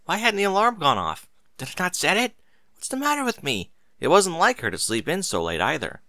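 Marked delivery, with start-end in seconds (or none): 0.0-3.7 high, fretful rush of questions; frantic worry, pitched much higher; flustered panic. Acted. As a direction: scold herself in a fluster. Mary panics about oversleeping.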